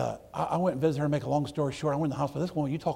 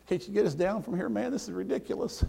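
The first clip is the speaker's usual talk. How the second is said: a higher, whining mock of the thief; 11 semitones higher, 3 dB louder than usual. Thief on the cross mocking Jesus, higher whine.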